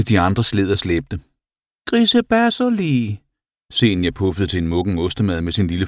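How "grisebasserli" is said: very high, drawn-out squeal; animal character. A narrator's reading. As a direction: sing it out in a tiny creature voice. Animal puffs at mouldy rubbish. (høj, langtrukken dyrestemme)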